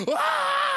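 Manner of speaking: high, loud mock yelp of terror; panicked scream. Father imitates his son's high panicked yelp in the river. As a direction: shriek like a drowning kid. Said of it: "my youngest son is in a sheer panic".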